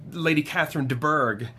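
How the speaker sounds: ordinary talk, nothing marked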